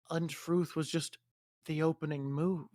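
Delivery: soft, hushed reveal; quiet battle aside. Acted. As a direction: drop to a near murmur. Acted sly line in battle.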